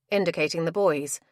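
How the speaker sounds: ordinary delivery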